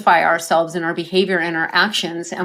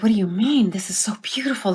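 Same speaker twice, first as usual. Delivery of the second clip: breathless whisper of wonder; awed whisper; 3 semitones higher, faster than usual. Retold or imitated. Father whispering in awe to his dead wife. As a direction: whisper it as if seeing heaven.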